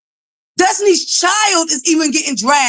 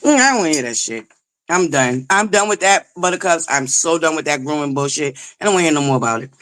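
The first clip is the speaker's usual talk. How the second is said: much lower, dismissive, closing the topic; fed up and done; 11 semitones lower than usual. Real, not acted. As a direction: drop it low, like you're completely over it. Fed up, dropping the subject.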